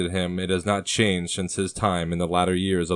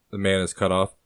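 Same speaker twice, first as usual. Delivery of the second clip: ordinary delivery, nothing marked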